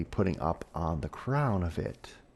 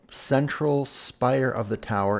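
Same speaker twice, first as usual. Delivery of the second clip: ordinary delivery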